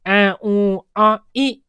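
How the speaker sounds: lightly imitated coos "ऐ, ऊ, आ, ई", each vowel drawn out separately; baby cooing sounds. Shown as a demonstration. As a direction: demonstrate a baby's "ऐ, ऊ, आ, ई" as separate vowels. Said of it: "ऐसे करना शुरू कर देता है बच्चा".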